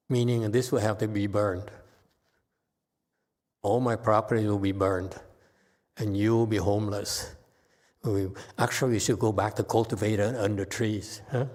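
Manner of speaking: ordinary talk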